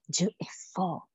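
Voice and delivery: slow, breathy whisper of "Dieu est fort"; whispered awe. Real, not acted. Repeat dropped to a whisper.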